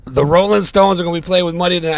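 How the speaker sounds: high, loud, fast insistence; insistent. Retold or imitated. Drummer insisting to his doubting friend, loud and fast. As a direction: make him believe it.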